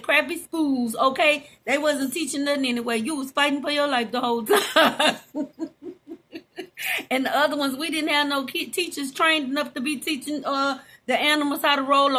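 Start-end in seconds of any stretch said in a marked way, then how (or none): 5.2-7.1 soft rising giggle; a quiet laugh that lifts at the end; real laugh. Real, not acted. Laughing about crappy schools to viewers, soft giggle.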